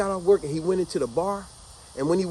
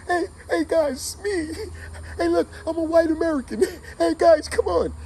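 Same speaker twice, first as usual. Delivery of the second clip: desperate cry for help; 12 semitones higher than usual. Retold or imitated. Crying out to soldiers for help.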